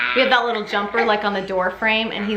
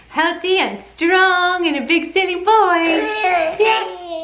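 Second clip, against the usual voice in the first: high, louder sing-song parentese; 10 semitones higher, 3 dB louder than usual. Real, not acted. Coaxing baby to wave bye-bye.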